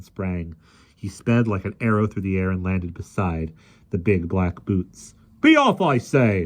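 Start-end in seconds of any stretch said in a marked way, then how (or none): 5.4-6.5 loud, slow protest; protesting shout. A narrator's reading. Policeman protests at the lion, slow loud cry. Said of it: "in a last protesting cry".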